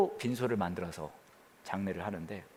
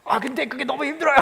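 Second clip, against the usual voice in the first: high, whiny, loud plea of a tired believer; a believer whining to God that it's too hard; whiny complaint; 6 dB louder than usual. Retold or imitated. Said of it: "그럼 우리 그렇게 얘기하겠죠".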